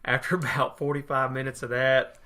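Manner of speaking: ordinary delivery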